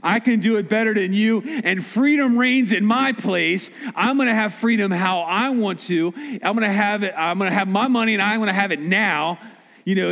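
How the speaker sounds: high, pushy mock defiance toward God; defiant self-talk. A sinner telling God it's my life, in mock defiance.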